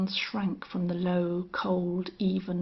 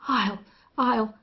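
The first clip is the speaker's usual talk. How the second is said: louder, sputtered rage; wild sputtering; 3 semitones higher, 5 dB louder than usual. A narrator's reading. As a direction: burst out, stuck for words. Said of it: "returned Buster Jack wildly, bursting for expression".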